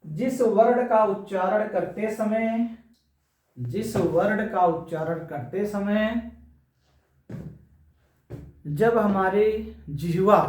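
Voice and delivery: slow, measured dictation; deliberate classroom dictation; dictating a definition. Shown as a demonstration. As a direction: spell out the definition phrase by phrase.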